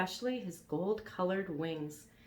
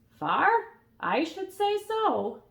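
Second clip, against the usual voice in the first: put-on old gull; 13 semitones higher, 7 dB louder, slower than usual. Old gull answers Elmer.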